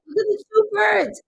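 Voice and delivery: a hint of an excited child's high voice; high-pitched, lightly childlike mimicry; kid voice. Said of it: "sounds like a kid voice".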